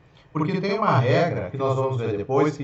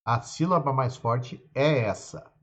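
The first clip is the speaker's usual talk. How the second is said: ordinary delivery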